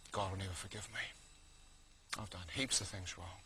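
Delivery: soft, low admission of guilt; subdued self-doubt. Retold or imitated. Dying man doubts God would forgive him, subdued. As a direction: confess it quietly to yourself.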